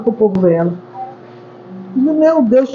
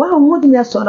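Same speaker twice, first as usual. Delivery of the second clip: retold alarmed cry; 7 semitones higher than usual. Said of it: "A mulher começou a gritar".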